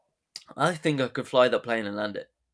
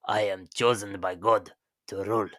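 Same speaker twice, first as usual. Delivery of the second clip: put-on Russian accent gone Spanish; 3 semitones lower than usual. Podcaster practising Nicholas's line, failed Russian-Spanish accent. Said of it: "why so Spanish?".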